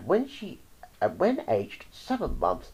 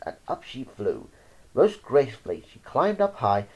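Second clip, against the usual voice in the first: ordinary delivery, nothing marked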